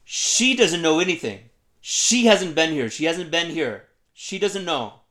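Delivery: loud, high mock scream; hammered-out shouts of protest, each falling at the end; imitated screaming. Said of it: "Sharat starts screaming at me".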